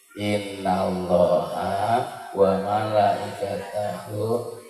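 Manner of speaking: slow, low Quranic recitation; Arabic recitation. Acted. Preacher reciting salawat for the congregation, slow and low. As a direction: recite the salawat slowly, drawing out the syllables.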